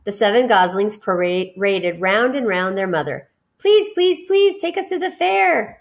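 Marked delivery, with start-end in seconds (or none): 3.6-5.8 high, squeaky little gosling pleading; high gosling voice begging to go. Acted. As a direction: beg like a tiny gosling. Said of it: "begged a gosling".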